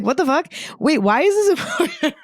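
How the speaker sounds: high, baffled squeak at her own welling emotion; startled at own tears. Retold or imitated. Telling listeners how she reacted to tearing up, high and startled. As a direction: pitch up, caught off guard by tears. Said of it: "I'm like".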